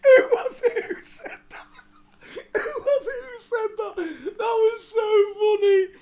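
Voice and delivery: high-pitched, breathless laughter through the words; laughing. Real, not acted. Reacting to a viewer's joke, laughing hard. Cracking up over chat comment.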